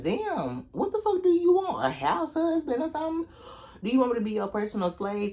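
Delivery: high, far-off mock outrage; incredulous. Venting to viewers about a boyfriend, high incredulous scoff. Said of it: "And I'm thinking".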